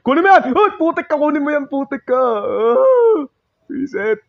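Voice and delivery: very high, loud desperate shouts; screaming panic at the game; frantic panic yelling. Real, not acted. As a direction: yell in panic while being chased. Frantic panic during chase.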